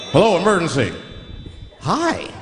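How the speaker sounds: breezy, casual caller with lifted pitch; cool and relaxed. Acted. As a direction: play an absurdly relaxed emergency call. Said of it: "cool and relaxed".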